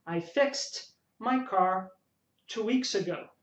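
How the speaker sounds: slow model line, fixed said fikst; slow teacher model with fixed ending in t; slow demo sentence. Shown as a demonstration. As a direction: spell out fixed slowly for students. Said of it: "Então você vai falar fixed".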